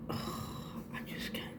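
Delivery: breathless whisper, overwhelmed; overwhelmed fan whispering in awe; awed whisper. Real, not acted. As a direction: whisper it, lost for words. Overwhelmed by the light stick.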